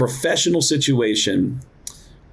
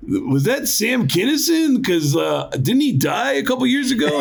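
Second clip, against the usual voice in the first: caller voice; 10 semitones higher than usual. Retold or imitated. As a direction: sound like a stunned radio listener. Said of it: "the first call was".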